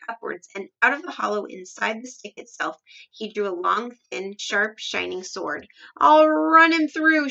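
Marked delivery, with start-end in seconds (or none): 6.0-7.3 high, very loud, drawn-out battle cry; shouted threat. A narrator's reading. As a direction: roar it like a charging swordsman. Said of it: "she shouted".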